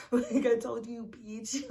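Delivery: loud, laughing "I told you"; gloat, laughing, you were right; gloating inner voice. Retold or imitated. Gloating at the boyfriend in her head, loud and laughing. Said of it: "in my mind, I'm like".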